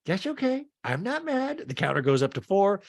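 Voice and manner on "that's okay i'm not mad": high, sing-song mock denial; Meredith. Meredith brushes off Angie, high imitated denial. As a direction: pitch it way up, falsely fine. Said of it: "And Meredith's like".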